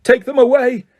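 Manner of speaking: very high, loud roar. A narrator's reading. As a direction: roar the order. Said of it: "Crouch roared".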